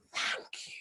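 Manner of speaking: soft, breathy, hissing snake voice begging for help; a tiny frightened snake calling out in a whisper. Acted. Small snake begging the princess to hide it, hushed whisper.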